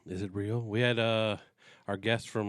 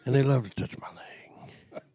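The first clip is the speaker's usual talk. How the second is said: whisper; 4 dB louder, slower than usual. Acted. Whispered joke line.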